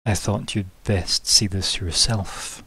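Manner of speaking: low, slow in-character reply; long-haired mysterious figure. Acted. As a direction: speak as the dark-haired figure, unhurried and low.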